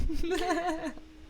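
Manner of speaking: high giggle; laughing. Real, not acted. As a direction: giggle hard. Finds robot voice funny.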